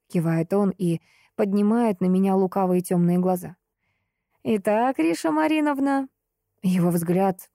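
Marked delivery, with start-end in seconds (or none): 4.4-6.1 lofty, pompous address; высокопарно. Acted. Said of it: "также высокопарно". (высокопарно и торжественно)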